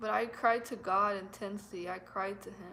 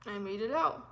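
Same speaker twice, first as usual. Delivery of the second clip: tearful relief. Real, not acted.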